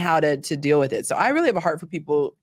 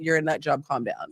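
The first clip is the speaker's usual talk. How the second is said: lower, softer teasing reassurance; joking calm-down; 3 semitones lower, 5 dB quieter than usual. Retold or imitated. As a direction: settle the panicking partner with a tease. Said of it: "I say that jokingly".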